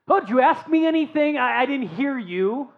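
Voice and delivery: high, loud, fast pretend not-hearing; mock feigned deafness. Grumpy fisherman pretending not to hear Jesus, mock sarcastic. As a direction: pretend you didn't catch the question, sarcastically. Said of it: "a little frustrated, grumbling".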